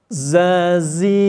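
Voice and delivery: slow, each buzzing z held; drilled z syllables. Shown as a demonstration. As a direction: model the z syllables for the class. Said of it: "kita bunyikan ya".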